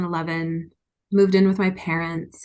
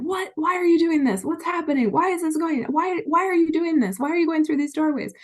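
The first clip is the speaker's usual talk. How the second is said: much higher, frantic mock husband voice; bewildered husband; 10 semitones higher than usual. Husband watching the bills pile up, in a high mock-panicked voice. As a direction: squeak out his baffled questions in a high panic. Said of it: "he's in the back looking at the bills coming in and going".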